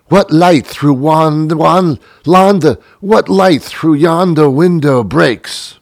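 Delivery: slow, grand theatrical recitation; pompous stage actor reading Romeo; mock Shakespeare. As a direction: ham it up like a bad Romeo. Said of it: "Shakespeare was the worst of all".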